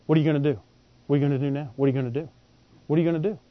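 low, soft, nagging taunt repeated; devil's taunt. Retold or imitated. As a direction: whisper doubt into their ear like the devil. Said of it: "here's a mantra".

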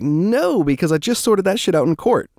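high, loud insistent denial to the cop; emphatic protest. Retold or imitated. To the cop who pulled him over, a high emphatic protest. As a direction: protest it, sure you're in the right.

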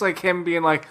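ordinary talk, nothing marked

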